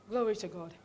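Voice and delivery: ordinary talk, nothing marked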